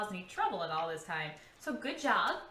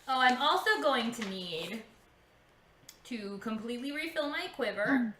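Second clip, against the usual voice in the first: usual speaking voice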